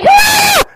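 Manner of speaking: piercing high scream; sudden ear-splitting screech; blood-curdling scream. Retold or imitated. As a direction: imitate a girl's shrill shriek, full out. Imitates the girl's screaming.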